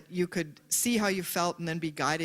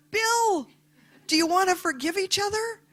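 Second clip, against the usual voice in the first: much higher and louder, gushing; bursting with joy at making peace; elated plea; 7 dB louder than usual. Retold or imitated. Reconciliation with driver, excited.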